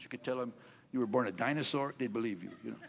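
ordinary delivery

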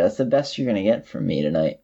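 ordinary talk, nothing marked

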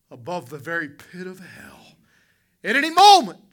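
high, loud shouted warning; preacher's shout. Acted. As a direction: cry it out over the congregation. Hellfire sermon climax.

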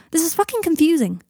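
high-pitched, exasperated burst; frustrated outburst. Acted. Harry overwhelmed by Draco's confession, frustrated. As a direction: let the confusion burst out after a sigh.

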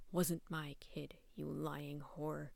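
low, slow growled insult. Acted. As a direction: growl it with contempt. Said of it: "The possessed cat growled".

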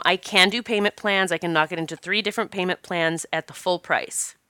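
usual speaking voice

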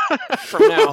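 bursting into laughter, high and loud; laughing. Real, not acted. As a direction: laugh through the words at the silly idea. Joking about podcast in 2052.

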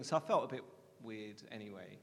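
usual speaking voice

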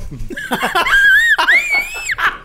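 long high burst of laughter; real laughter breaking out; loud laughing shout. Real, not acted. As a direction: crack up loudly. Laughing at his fainting.

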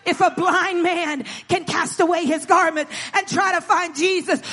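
loud, high, building pulpit cry; full-force preaching. Real, not acted. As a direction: hammer each phrase at the congregation.

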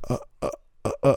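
tiny, very soft stammered uhs; lost for words. Retold or imitated. Girl caught gossiping by patient, lost for words. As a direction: mimic someone caught out and speechless.

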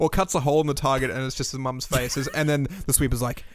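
normal talking voice

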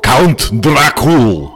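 a campy Count welcoming guests to his castle; Dracula accent. Acted. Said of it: "I'm not gonna do an accent".

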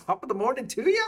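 mock stage Irish greeting; Irish accent. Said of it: "That's my Australian and my".

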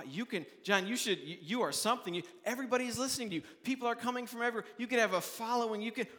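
rising excited flattery. Acted. Tempters urging John to gather a following, fast excited gushing. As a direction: build from coaxing to breathless hype.

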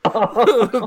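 high, loud retching noise; hammed-up puking sound; imitated vomiting. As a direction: mimic another violent heave. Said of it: "vomiting in the tub".

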